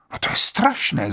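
high, falling cry of dismay; shocked exclamation. A narrator's reading. Vera hearing of the death, exclaims in shock. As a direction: gasp it out in horror. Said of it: "zvolala Vera".

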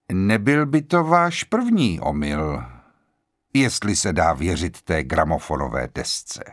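measured, slowed delivery; deliberate. A narrator's reading. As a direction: weigh each word before it lands. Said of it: "Blor řekl uvážlivě". (uvážlivě, pomalu)

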